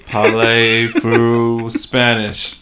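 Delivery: slow, loud hammed-up French accent; mock French accent. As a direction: drag it out in a cheesy French accent. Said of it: "Killed it".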